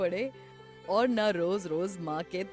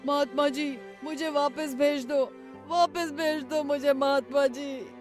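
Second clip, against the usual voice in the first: high, loud pleading cries; desperate shouting; 5 semitones higher, 4 dB louder than usual. Acted. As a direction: shout for the holy man to free you. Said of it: "Ramu जोर जोर से चिल्लाने लगा".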